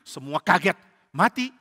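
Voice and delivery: plain reading voice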